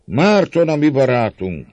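loud, high-pitched cheer; roaring crowd. A narrator's reading. Crowd hailing Márton as their friend, roaring shout. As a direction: shout it out for a hundred voices. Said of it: "Ordít a száz hang".

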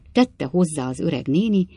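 ordinary delivery, nothing marked

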